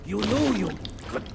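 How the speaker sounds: rising taunt. Acted. Villain telling the hero he's a scared boy, mounting high taunt. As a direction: build from taunt to gleeful mockery.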